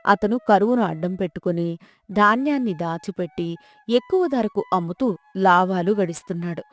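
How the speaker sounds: plain reading voice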